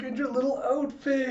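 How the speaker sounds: very high cooing; gushing adoration. Acted. Colleagues admire the child's outfit, gushing. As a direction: coo at a small child, high-pitched.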